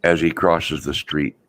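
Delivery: ordinary talk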